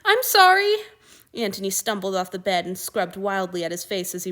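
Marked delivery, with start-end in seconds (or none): 0.0-0.7 much higher and much louder, crying it out; a desperate wailing sorry; tearful apology. A narrator's reading. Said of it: "Crying doesn't make the problem go away".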